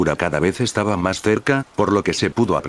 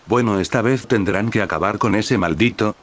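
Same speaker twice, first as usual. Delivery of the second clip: plain reading voice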